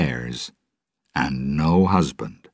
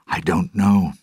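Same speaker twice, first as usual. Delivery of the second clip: usual speaking voice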